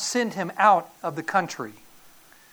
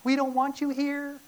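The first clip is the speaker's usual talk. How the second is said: people in darkness; 6 semitones higher, 4 dB quieter than usual. Acted. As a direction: stretch it out like a crowd turning someone away. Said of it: "those that are in darkness".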